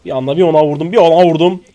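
loud, rising excited yell; excited shout. Real, not acted. Streamer yells to his team about his hit, excited. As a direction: blurt it out as the hit lands.

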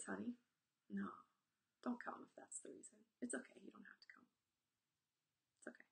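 calm him down softly; gentle reassurance. Retold or imitated. Father gently reassures son.

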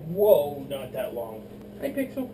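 normal talking voice

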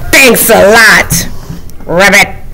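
low, slow sarcastic frog; grumpy frog. Acted. Frog to the reader who turned the page, grumpy. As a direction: grumble it low, ending on a croak word.

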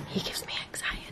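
whispered aside. Real, not acted. Complaining about Cody to camera, whispered aside.

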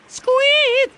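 very high, loud squeak; piercingly high mock ringtone; imitated phone squeak. A narrator's reading. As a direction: squeak like an insistent phone alert. Imitates the phone's alert sound.

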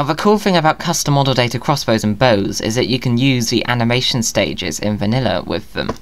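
ordinary talk, nothing marked

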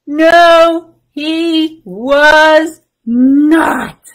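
loud, very drawn-out NO, HE WAS NOT; emphatic refrain. Acted. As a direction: punch each word of "No, he was not" separately. Storyteller's emphatic refrain answer.

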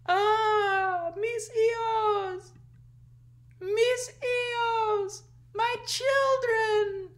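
high, slow, wailing cry; La Llorona wailing. Acted. La Llorona crying for her children, high slow wail. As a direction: wail like a ghost mourning her lost children. Weeping woman wails for children.

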